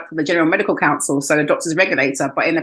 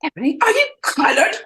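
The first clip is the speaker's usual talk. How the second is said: much higher, put-on posh old man; shocked posh caller; 10 semitones higher than usual. Posh doctor asking her race, high mock voice. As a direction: ask it with clueless surprise.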